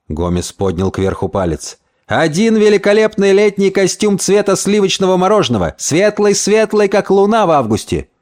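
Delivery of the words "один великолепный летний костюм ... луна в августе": high-pitched, rhapsodic sales pitch; excited schemer painting a dream; enthusiastic Gomez. Acted. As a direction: describe it as a marvel, pitched up.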